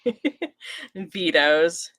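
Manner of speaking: ordinary talk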